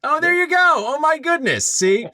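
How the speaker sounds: high, excited exclamations breaking into laughter; delighted surprise. Real, not acted. Host learns his guest edited the book, delighted and laughing. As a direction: burst out thrilled, voice shooting way up.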